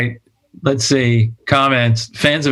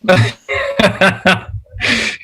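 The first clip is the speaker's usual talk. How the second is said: laughing; 7 semitones higher than usual. Real, not acted.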